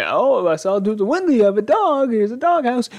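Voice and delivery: high, sing-song nosy neighbour voice; chirpy neighbour. Retold or imitated. Neighbour offering the family a dog house in a high sweet voice. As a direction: put on a sweet busybody neighbour.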